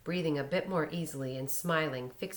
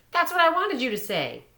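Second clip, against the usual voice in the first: unsure mock taunt, higher and louder; tentatively mocking; 10 semitones higher, 8 dB louder than usual. A narrator's reading. Boy on the tree tentatively mocks his friends. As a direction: test out a sneer, half-hearted. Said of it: "in a tentatively mocking voice".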